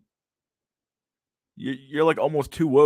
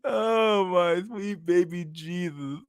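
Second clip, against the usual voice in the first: high-pitched, laughing voice; real laughter spilling into the words; laughing disbelief; 11 semitones higher, slower than usual. Real, not acted. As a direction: squeal it out, overcome with laughter. Cracking up in disbelief at video.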